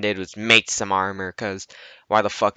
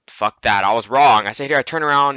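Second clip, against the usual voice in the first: ordinary talk, nothing marked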